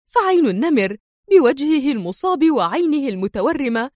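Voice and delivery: high, building exasperated accusation; resentful. A narrator's reading. Sonia accusing the three men in irritation. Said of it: "قائلة في حنق".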